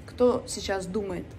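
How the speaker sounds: ordinary talk, nothing marked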